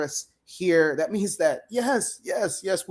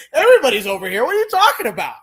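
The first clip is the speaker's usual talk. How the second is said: mock clueless reply; 8 semitones higher, 8 dB louder, faster than usual. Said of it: "You look like a fool".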